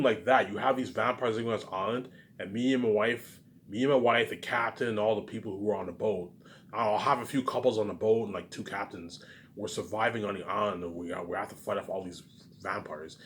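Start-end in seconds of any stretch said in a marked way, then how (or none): none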